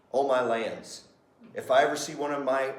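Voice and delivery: normal talking voice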